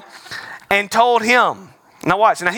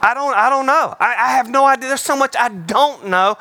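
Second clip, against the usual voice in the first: animated admission; 6 semitones higher than usual. Real, not acted. Laughing admission.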